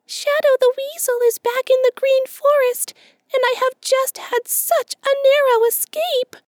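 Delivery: very high, small squeaky voice, scared; hushed frightened squirrel. A narrator's reading. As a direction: squeak it as if afraid of being overheard. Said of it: "still in a very low voice".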